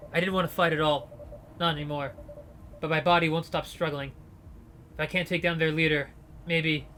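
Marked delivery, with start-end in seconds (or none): none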